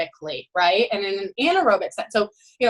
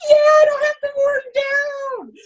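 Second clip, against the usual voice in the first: gleeful swimmer; 6 dB louder, slower than usual. Retold or imitated.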